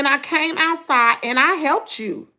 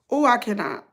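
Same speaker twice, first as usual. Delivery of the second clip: ordinary delivery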